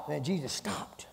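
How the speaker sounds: hushed whisper. Acted. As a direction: drop to a breathy hush. Sudden hushed narration.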